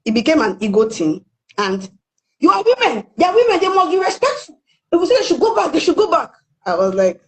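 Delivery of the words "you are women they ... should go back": high mocking voice imitating the guards; high-pitched mock lecture; mock officials. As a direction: parrot their smug rules, pitched up.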